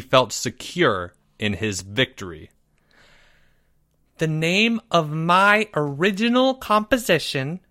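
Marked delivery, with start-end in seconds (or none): 4.2-7.6 higher proud announcing voice; confident announcement. A narrator's reading. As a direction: announce it like a big moment. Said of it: "he announced confidently".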